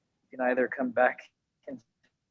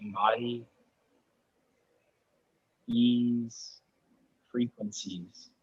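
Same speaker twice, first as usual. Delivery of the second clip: soft, unhurried meditation voice with long pauses; guided meditation; 6 dB quieter than usual. Real, not acted. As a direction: ease them gently into final rest, softly. Closing guided relaxation in yoga class.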